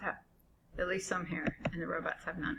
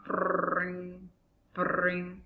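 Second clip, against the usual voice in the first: imitated phone ring. Dad imitates phone ringing.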